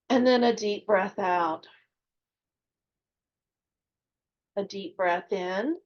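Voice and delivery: measured meditation voice with long pauses; guided meditation. Real, not acted. Guide leading listeners to meet their spirit guides, calm meditative delivery. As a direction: guide listeners gently inward, leaving space between phrases.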